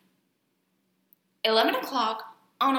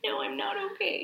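mock distress; 10 semitones higher, 5 dB quieter than usual. Playful reply, higher voice.

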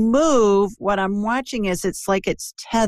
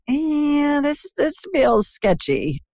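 higher, drawn-out hesitant 'eh'; wary gut feeling; 8 semitones higher, much slower than usual. Acted. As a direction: act out a slow, doubtful hunch. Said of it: "I'll get a gut feeling like".

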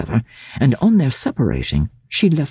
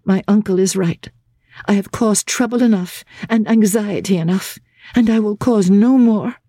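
higher, firm, hopeless voice; resigned despair; 7 semitones higher than usual. A narrator's reading. Laura refusing Marian's protest, firm resigned despair. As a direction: set in her despair, unmovable. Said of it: "inflexibly passive in her resignation".

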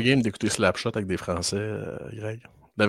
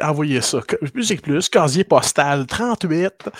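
mock announcer; 5 semitones higher, 5 dB louder than usual. Mimicking old TV call-in.

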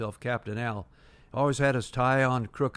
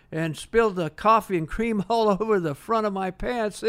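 laughing through the words, higher; 7 semitones higher, 3 dB louder than usual. Retold or imitated. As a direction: giggle your way through the coffee spill story. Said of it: "he's sort of laughing".

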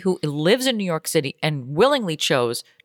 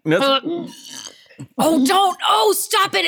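squeamish; 11 semitones higher, slower than usual. Real, not acted. Squeamish at the sound.

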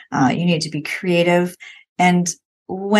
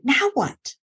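bright lifted "Now what?", pitch higher; excited; 7 semitones higher than usual. Shown as a demonstration. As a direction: toss the question out with eager delight. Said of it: "like, excited".

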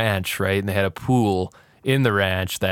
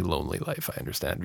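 hushed; 6 semitones lower, 11 dB quieter, faster than usual. Pretend. Mock-sympathetic aside, fading out.

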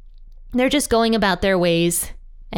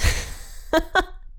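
quiet, airy chuckle; light self-amused laugh; soft laugh; 9 semitones higher, 5 dB quieter than usual. Real, not acted. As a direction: laugh softly at your own confession. Laughs at own chocolate confession.

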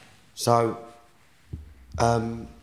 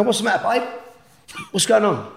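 much higher, louder worried question; confused concern; 11 semitones higher, 5 dB louder than usual. Retold or imitated. Husband waking to find his wife crying, higher worried call. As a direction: call out to her, bewildered. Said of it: "I go".

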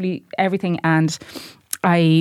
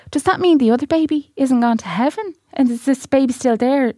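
higher-pitched imitation of a puzzled child; child's questioning; 7 semitones higher than usual. Son asking his mother about the babies, child's questioning. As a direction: voice an innocent kid's worried question. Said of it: "poor Ben was like".

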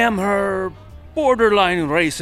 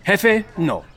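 put-on Spanish accent. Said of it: "I am loving that accent".

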